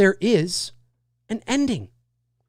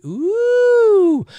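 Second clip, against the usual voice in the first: long, high rising-then-falling "ooh"; intrigued ooh; 12 semitones higher, 3 dB louder than usual. Real, not acted. Host reacting to a viewer's theory about Randy, intrigued.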